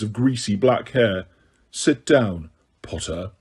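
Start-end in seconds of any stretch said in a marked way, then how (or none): 1.7-3.3 low, dropping Potter, softer; cold Snape. A narrator's reading. Snape commanding Harry to sit, cold and low. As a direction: Snape ordering Harry, lightly voiced.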